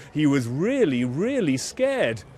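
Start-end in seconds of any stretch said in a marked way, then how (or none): none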